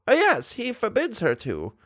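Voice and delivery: put-on high, fond old lady voice; tender grandmotherly coaxing. A narrator's reading. Old lady calls Raoul near like a boy, high.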